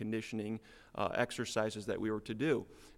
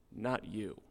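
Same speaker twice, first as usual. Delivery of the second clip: ordinary talk, nothing marked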